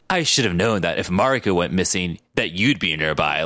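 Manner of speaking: low, brooding hero voice; deep accusing rival; grim Logan. Acted. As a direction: drop it low, like an anime tough guy.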